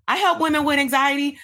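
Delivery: mock the vague niche statement; mocking vague version. Shown as a demonstration. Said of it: "How more attractive does that sound compared to".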